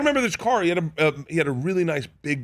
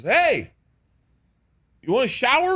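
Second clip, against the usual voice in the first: higher-pitched call from another room; raised, hailing voice, retold; imitated calling out; 10 semitones higher, 3 dB louder than usual. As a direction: call out an invitation, imitating someone. Snoop calls from his trailer.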